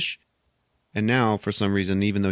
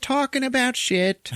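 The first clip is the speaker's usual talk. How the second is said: high-pitched put-on gossip voice; mock chit-chat. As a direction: parody empty salon small talk. Mocking salon small talk.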